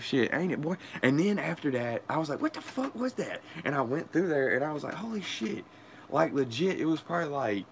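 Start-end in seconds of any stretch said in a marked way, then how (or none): 2.4-3.4 retold amazement. Discovering the passageway. 4.9-5.6 amazed. Retold or imitated. Seeing the secret hallway.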